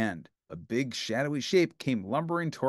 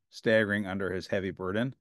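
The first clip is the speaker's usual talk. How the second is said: plain reading voice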